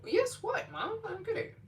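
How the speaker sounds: baffled squeal at mom. Real, not acted. Confused at mom's repeated "yes.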